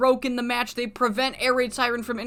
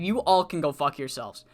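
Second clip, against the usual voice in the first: low contemptuous dismissal; 7 semitones lower than usual. Retold or imitated. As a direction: say it low in the throat with scorn, first time. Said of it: "and he said".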